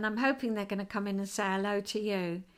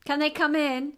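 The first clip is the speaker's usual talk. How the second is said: raised, higher-pitched call; 4 semitones higher, 4 dB louder than usual. Real, not acted. Asking for visitors to be sent in, calling out. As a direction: call through to the next room. Repeats call to other room.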